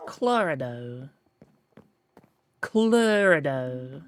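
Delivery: Clorido said slowly as klor-ih-doh; slowly sounding out Clorido, klor-ih-doh; attempted German. Shown as a demonstration. Slowly trying a German-sounding Clorido. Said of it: "That doesn't sound German".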